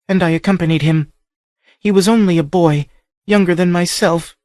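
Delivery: high voice close to breaking; faltering, grieving. A narrator's reading. Captive girl telling the stranger of her brother, faltering. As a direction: hold back the grief as she tells it. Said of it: "Her voice faltered and broke".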